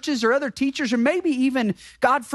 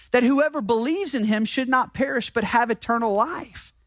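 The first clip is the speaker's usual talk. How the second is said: ordinary delivery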